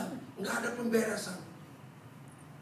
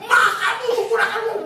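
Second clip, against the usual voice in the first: husband's rebuke; 8 semitones higher, 10 dB louder than usual. Retold or imitated. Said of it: "suaminya terpaksa negur istrinya".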